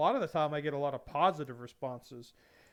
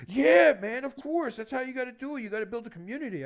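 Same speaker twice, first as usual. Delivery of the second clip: higher, louder bro enthusiasm; mock car-guy voice; 9 semitones higher, 7 dB louder than usual. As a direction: pump it up like the eager car guy.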